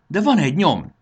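high, loud burst of hope; excited cry. A narrator's reading. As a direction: call out the sudden discovery. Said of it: "kiáltotta". (izgatott felkiáltás)

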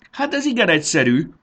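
high, loud call across the distance; calling back. A narrator's reading. Fanny calls back to Marci from the river, loud and high. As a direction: shout it back from afar. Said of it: "kiáltotta vissza".

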